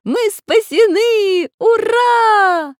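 high, loud, drawn-out hurrah; joyful shout. A narrator's reading. Children rescued from the sea, cheering with joy. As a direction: cheer at the top of your voice. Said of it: "весело закричали ребята".

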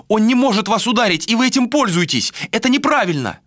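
high-pitched indignant protest; crying out. A narrator's reading. Malika protesting that Vilar is defenceless, crying out. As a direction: keep protesting high and urgent. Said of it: "вскричала Малика".

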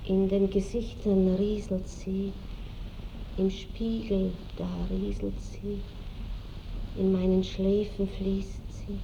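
soft, pensive monologue; hushed reflection. Acted. Marschallin telling her lover how time flows, softly musing. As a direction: let the words trickle out quietly. Muses on time silently flowing.

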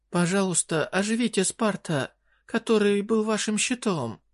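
high desperate queen voice; pleading fairy queen. Acted.

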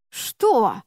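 ordinary delivery, nothing marked

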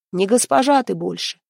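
ordinary delivery

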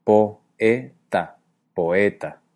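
POETA broken into po-e-ta, then whole with O and E apart; syllabified hiatus. Shown as a demonstration. Teacher demonstrates the three syllables of poeta, in careful hiatus. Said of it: "tendría tres sílabas".

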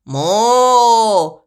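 long, droning moo; cow's moo. A narrator's reading. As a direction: moo along like a herd. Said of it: "сказали другие коровы". (протяжное мычание)

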